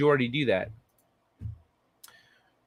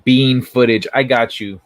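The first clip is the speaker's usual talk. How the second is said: excited; 11 dB louder than usual. Real, not acted. Excited reply to chat.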